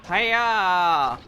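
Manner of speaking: loud sharp battle yell; battle cry. Acted. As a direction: shout it like a strike. Voicing a character's battle cry.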